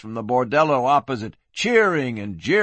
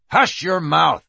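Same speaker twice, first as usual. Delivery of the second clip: sharp, raised-voice silencing order; hissed rebuke; 5 semitones higher, 5 dB louder than usual. A narrator's reading. McCrow silences his partner, hissing angrily. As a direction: shut him up angrily. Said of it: "McCrow hissed".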